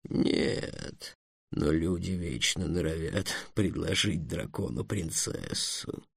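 low, slow, soft dragon bitterness; bitter. Acted. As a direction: complain quietly and bitterly, taking your time. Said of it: "горько сказал Эллен". (горько, тихо и медленно)

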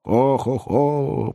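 drawn-out mocking echo of a laugh; echoing mystery voice. A narrator's reading. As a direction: mimic the laugh as an eerie repeat. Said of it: "повторил чей-то голос за его спиной".

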